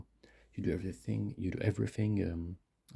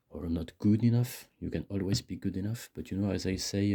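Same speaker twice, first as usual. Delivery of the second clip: usual speaking voice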